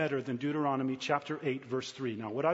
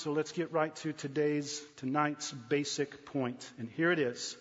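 normal talking voice

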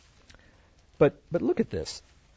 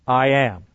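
"I am" said louder, a bold declaration; emphatic I am; 6 dB louder than usual. Acted. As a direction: land "I am" like a proclamation. Jesus's answer at the arrest.

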